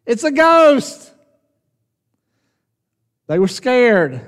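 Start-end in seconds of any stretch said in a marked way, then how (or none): 0.0-1.1 high, loud, slow cry of terror; panicked sailors screaming out; terrified scream. Retold or imitated. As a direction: shriek it like you've seen a ghost. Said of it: "they scream out and they say".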